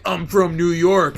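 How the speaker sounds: loud, showy announcement; mocking a transplant's loud pride; mock loud boast. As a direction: brag loudly like a newcomer. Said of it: "they're like".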